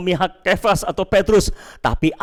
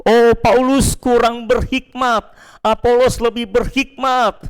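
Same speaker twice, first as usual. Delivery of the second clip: pitched-up partisan comparing teachers; Apollos partisans; 5 semitones higher than usual. Acted. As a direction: argue for your favourite, stretching the words. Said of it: "namanya kelompok Apolos".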